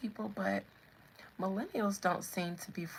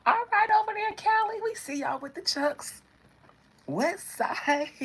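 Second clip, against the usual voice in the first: higher-pitched, playful shout-out; friendly acknowledgement; 13 semitones higher, 6 dB louder than usual. Acted. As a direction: hype them up in a playful greeting. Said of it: "Everybody else just be like".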